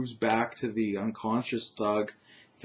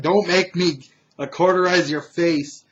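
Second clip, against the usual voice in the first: raised, louder threatening voice; menacing threat; 6 semitones higher, 6 dB louder than usual. Acted. Wizard with glowing hand threatening a thug, loud menace. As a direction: threaten loudly, pitched up.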